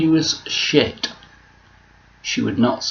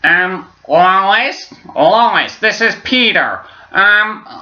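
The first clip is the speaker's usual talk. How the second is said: higher, louder cartoon dad voice; imitating a lazy Peter Griffin impression; mock Peter Griffin; 11 semitones higher, 5 dB louder, much slower than usual. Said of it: "most people would go".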